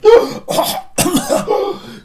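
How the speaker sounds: loud, high gasping cough; choking gasp for breath. Acted. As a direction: splutter as air rushes back after being throttled. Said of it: "seems to get his breath back".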